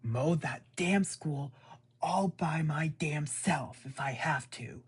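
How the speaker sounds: loud, slow, pressed-out mock fury; mock furious threat. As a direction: spit out each damn like a mad mom. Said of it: "she's like".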